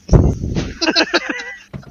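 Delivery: a high, delighted laugh that breaks out after the punchline. Real, not acted. Man laughing at his own language joke, high delighted laugh.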